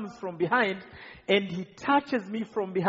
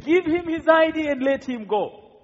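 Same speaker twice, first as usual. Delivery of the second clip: higher, louder command; a mild mock yell of a commander; retold shout; 7 semitones higher, 5 dB louder than usual. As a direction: call out the order across the road. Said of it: "I heard him shouting".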